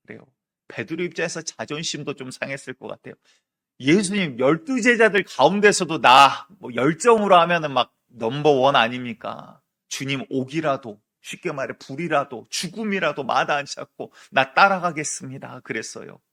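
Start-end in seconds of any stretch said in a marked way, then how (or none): 3.8-15.6 proud loud boast. Acted. Peter, pride stung, loudly vows to Jesus he'll follow to death. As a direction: boast your zeal to the whole room.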